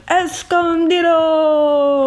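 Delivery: high, drawn-out Mexican announcer cry; hammed-up Mexican wrestler accent. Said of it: "You could have been like".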